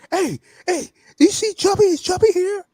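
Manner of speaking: high-pitched calling chef voice; excited chef. Retold or imitated. Chef coming in looking for Chubby, high excited voice. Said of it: "then the chef comes in".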